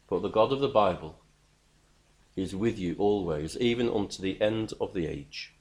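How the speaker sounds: ordinary delivery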